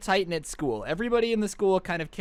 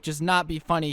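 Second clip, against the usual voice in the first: ordinary talk, nothing marked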